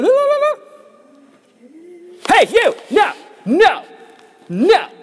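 very high, loud hammed-up cries; demo demon yelling. Shown as a demonstration. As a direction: ham up a shrieking demon. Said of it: "[name], start, like, talking in weird voices".

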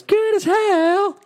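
belted high shout of the title; sing-shout it like the chorus. Acted.

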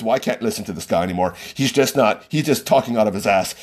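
low, quick dismissive mutter as the fed-up doctor; lightly voiced annoyed doctor hanging up; mock grumpy doctor. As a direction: grumble it low and fast like a doctor done listening. Mocking doctor who hung up.

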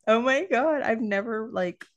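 high, gushing disbelief; excited gush. Retold or imitated. Mom gushing at her little girl, excited. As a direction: gush at her like she's new to you. Mother gushing over daughter.

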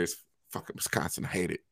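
whispered grumble under breath; muttered aside. Real, not acted. Grumbling about the cold aside.

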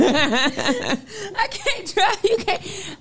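high mock protest broken by laughter; laughing mimicry. Replaying the car fight for laughing friends, high giggly mimicry. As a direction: repeat her wail, cracking up.

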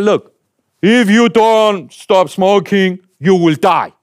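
slow, loud blunt warning from a doctor; stern doctor warning. Retold or imitated. Doctor bluntly warning a smoker patient, slow and stern. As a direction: spell out the threat slowly, like a stern doctor. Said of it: "The doctor said".

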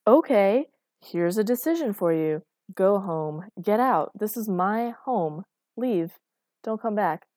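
loud, emphatic orders to leave; heated, punched commands; angry dismissal. Acted. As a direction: throw them out in short, forceful bursts. Acted quarrel, throwing her out.

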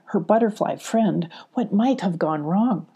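usual speaking voice

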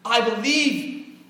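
high, loud, slow drawn-out plea; desperate cry. Acted. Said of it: "the father cries out".

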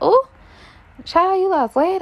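high, loud gushing auntie voice; high excited "ooh child" compliment; people gushing. Retold or imitated. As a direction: squeal it like an excited church lady. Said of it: "People was like".

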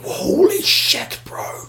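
breathless high whisper of awe; awed whisper. Retold or imitated. Watching a mate's huge jump, an awed whisper. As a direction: whisper it like you can't believe it.

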